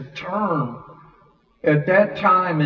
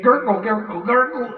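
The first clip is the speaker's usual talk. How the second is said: higher, bouncing gurgle words; mimicked gulping; 7 semitones higher, 3 dB louder than usual. Storyteller showing his friend downing the drink, mimicked gulping. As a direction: act out a drink going down fast. Imitating a drink being downed.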